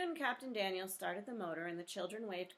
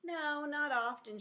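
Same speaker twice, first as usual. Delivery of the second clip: louder, higher, slow shout; big sister shouting over the noise; 9 semitones higher, 7 dB louder, slower than usual. Acted. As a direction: call out over a howling child. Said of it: "shouted Jessie over the noise".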